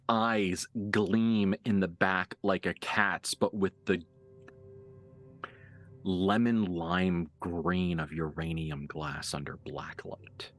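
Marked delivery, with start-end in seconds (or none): 6.0-10.5 game master narration. Acted. GM describes two unhumans recognising each other, low suspenseful narration. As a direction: let each image hang, low and measured. GM's hushed scene setting.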